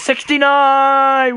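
high, loud, drawn-out whoop of triumph; excited shout. Real, not acted. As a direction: stretch it out in a celebratory yell. Gamer celebrating a number.

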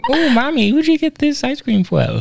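dad imitating his daughter asking about ice cream; child's voice. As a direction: squeak it like a small daughter. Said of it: "what's that thing I say that Solace".

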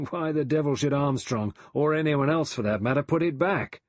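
loud, high-pitched disbelief; exasperated challenge. A narrator's reading. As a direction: challenge an unbelievable story, voice climbing.

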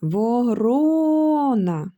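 slow calling tone with "ро" prolonged in "Ворона". Shown as a demonstration. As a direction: call "Ворона", stretching the stressed "ро". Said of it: "Кличемо ворону".

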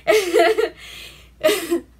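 can barely get it out for laughing; laughing hard. Real, not acted. Telling a friend about the dog fight, cracking up. Retelling funny movie scene, cracking up.

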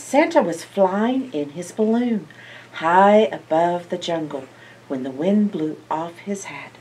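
usual speaking voice

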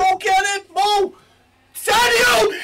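high, loud cheering at the screen; excited shout. Real, not acted. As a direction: yell the players on. Cheering an attack live.

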